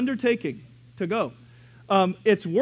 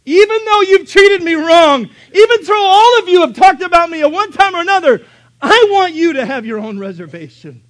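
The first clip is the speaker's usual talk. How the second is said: much higher, louder tearful plea; pleading cry; 11 semitones higher, 15 dB louder than usual. Acted. Samaritan woman offering hope to those who wronged her, tearful. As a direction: plead through tears. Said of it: "She said".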